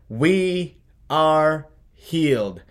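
WE ARE HEALED slow and high, each word held; high, ringing, slow stress on each word of "We are healed"; emphatic scripture quote. Real, not acted. Climactic quote of the verse.

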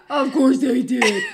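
slow, laughing indulgent tone; mock doting husband; patronizing. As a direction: pat her on the head with words. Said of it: "patting me on the head".

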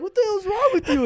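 very high, loud squeal of disbelief; mock shocked shriek. Cousins seeing the sister punched, mock shocked shriek. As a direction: shriek it, half laughing.

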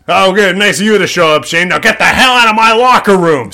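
imitating the coach screaming him out of the locker room; mock angry scream. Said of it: "screams at him".

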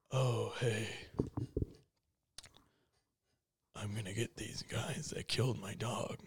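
low, soft, dead-flat mock Keanu; Keanu impression. Mocking the actor's line reading, low mumbled monotone. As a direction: mumble it like a wooden action hero. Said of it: "No one talks like that".

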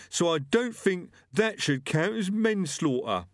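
high-pitched, put-on aggrieved killer; self-justifying butcher. Dead killer justifying himself to Death, indignantly. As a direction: argue your case high, tagging it "right?". Gripper's held voice.

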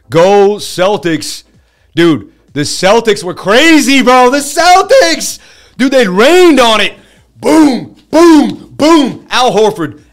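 high, loud, drawn-out hollering; hyped sports fan. Real, not acted. Fan raving to his stream about the Celtics, hyped. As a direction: whoop it up, stretching the big words.

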